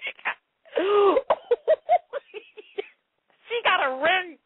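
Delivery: words breaking up in real laughter; laughing hard. Real, not acted. Host repeating the listener's ring comment, laughing helplessly. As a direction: try to get it out in a high voice while cracking up. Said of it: "I'm laughing so hard".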